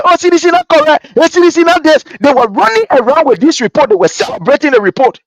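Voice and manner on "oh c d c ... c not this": mocking rivals. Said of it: "They say".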